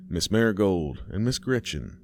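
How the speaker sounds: ordinary delivery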